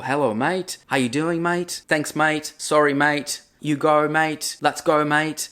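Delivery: "mate" in a light Australian accent; friendly Aussie greetings, lightly voiced. Shown as a demonstration. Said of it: "Você vai falar oi pra pessoa". (sotaque australiano leve)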